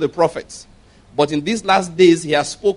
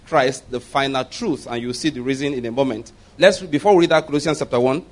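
ordinary delivery

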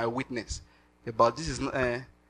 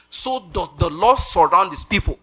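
preached scripture; 3 semitones higher, 6 dB louder, slower than usual. Acted. Scripture quoted with force.

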